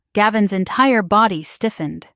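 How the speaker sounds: ordinary delivery, nothing marked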